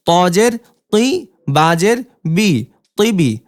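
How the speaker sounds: teacher's spelling drill cadence; syllable drill reading. Shown as a demonstration. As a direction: clear letter-by-letter spelling chant.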